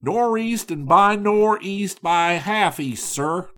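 ordinary delivery, nothing marked

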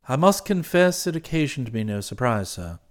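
low, soft, measured valet's report; composed valet Jeeves. Acted. Valet reporting the meeting to his employer, composed and formal. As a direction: report every detail with unruffled formality.

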